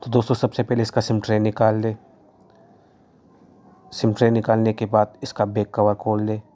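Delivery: ordinary talk, nothing marked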